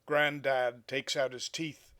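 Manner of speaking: measured poetry reading, projected above chatting level; poem recitation. Acted. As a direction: read the poem out phrase by phrase, with pauses between images. Reads a poem aloud.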